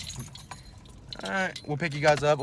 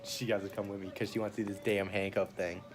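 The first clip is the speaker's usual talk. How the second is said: usual speaking voice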